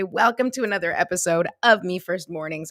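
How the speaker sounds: normal talking voice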